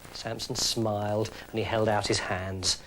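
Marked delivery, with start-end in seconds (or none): none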